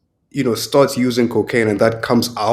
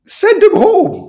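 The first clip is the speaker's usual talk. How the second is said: much higher, slow exasperated call; outraged demand; 4 dB louder, slower than usual. Real, not acted. Pundit demanding the referee be sacked, slow outraged call. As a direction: send him home, drawn out and indignant.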